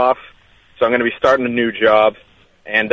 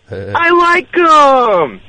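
slow, stretched and much higher host voice; host impression; 14 semitones higher, 3 dB louder, much slower than usual. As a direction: drag it out like the host. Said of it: "You're doing a pretty good impression".

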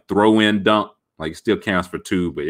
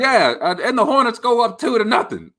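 much higher excited call; hyped announcer; 14 semitones higher than usual. Retold or imitated. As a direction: announce the score like a homer. Continuing the announcer imitation.

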